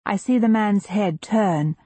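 ordinary delivery, nothing marked

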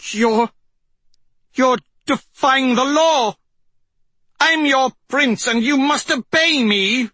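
high, outraged royal voice; stammer, then demand obedience loudly; indignant prince. Acted.

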